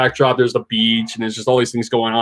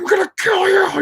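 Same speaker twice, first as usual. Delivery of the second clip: imitated rage, strained and much higher; mock a raging threat without full volume; enraged Barry. Barry finally raging at her on the phone, mock furious. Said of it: "He finally lets out all this rage".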